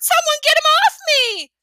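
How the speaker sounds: high, loud panicked yell; woman in red suit shrieking at Fudge; shouted. A narrator's reading. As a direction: scream at the kid to get off. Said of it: "she shouted".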